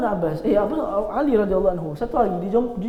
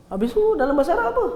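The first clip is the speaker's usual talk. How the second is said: eager question; 9 semitones higher, much faster than usual. Retold or imitated.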